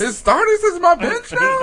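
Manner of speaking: high outraged question; incredulous. Real, not acted. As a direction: disbelief at the trade. Mock outrage at the trade.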